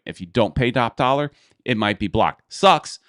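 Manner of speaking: ordinary talk, nothing marked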